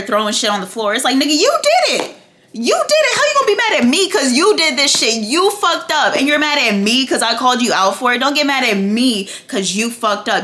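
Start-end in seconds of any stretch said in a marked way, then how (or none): 1.2-10.4 high, loud, fast scolding; screaming argument. Retold or imitated. Queen arguing back at her screaming husband, shouted accusation. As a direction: yell the blame right back in full force. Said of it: "He's screaming at her".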